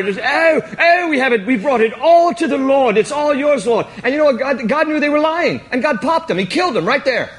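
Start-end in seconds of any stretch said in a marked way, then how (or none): 0.2-3.9 higher, louder hammed-up piety; gushing, over-the-top pious offering voice; mock pious couple. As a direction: play the fake-humble givers to the hilt. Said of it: "I had this picture here of Ananias and Sapphira".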